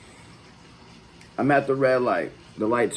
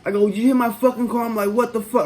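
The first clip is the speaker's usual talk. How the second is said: sharp high angry yelling; cussing him out; 8 semitones higher, 4 dB louder than usual. Retold or imitated. Retold curse-out at the other driver, loud and higher. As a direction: cuss out the driver who hit the car. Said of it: "I'm cussing dude out".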